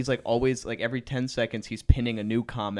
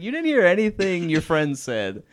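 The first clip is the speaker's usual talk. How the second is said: mock yelling; 7 semitones higher, 6 dB louder than usual. Retelling girlfriend yelling at boyfriend.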